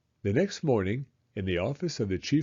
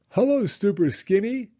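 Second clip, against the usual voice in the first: loud, higher mocking call; taunting shout; 10 semitones higher, 6 dB louder than usual. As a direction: jeer at him across the street. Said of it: "they would shout".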